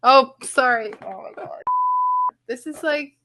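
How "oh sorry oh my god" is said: normal talking voice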